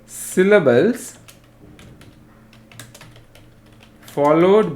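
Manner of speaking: slow, drawn-out dictation; piece-by-piece classroom dictation; dictating. Real, not acted. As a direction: leave long gaps for students writing it down. Teacher dictates definition for note-taking.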